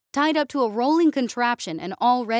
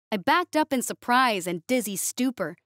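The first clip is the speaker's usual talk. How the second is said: plain reading voice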